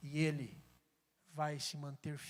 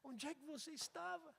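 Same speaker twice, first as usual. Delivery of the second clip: tearful; 9 semitones higher, 6 dB quieter than usual. Acted. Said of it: "ferida na alma, e dizendo assim".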